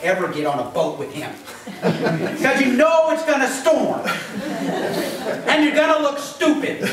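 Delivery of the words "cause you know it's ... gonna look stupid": laughing. Real, not acted. Laughing at own joke.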